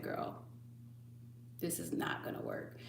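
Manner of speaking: hushed whisper to the cat; late-night whisper so as not to wake anyone; whispered scolding. Retold or imitated.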